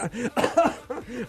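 wheezy laugh. Real, not acted. Host laughs.

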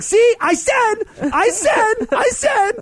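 triumphant sing-song, very high and loud; gloating. Retold or imitated. Dad gloats to his son he said so, very high and loud. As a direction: crow that you were right. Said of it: "It's like".

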